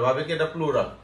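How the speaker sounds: normal talking voice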